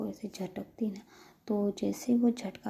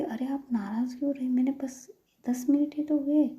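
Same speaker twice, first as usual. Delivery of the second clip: higher-pitched, mildly indignant protest; defensive protest; 5 semitones higher, 5 dB louder than usual. A narrator's reading. Employee protesting to an annoyed boss, defensive. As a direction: plead your case, pitch rising, louder at the end. Ritika protests against being blamed.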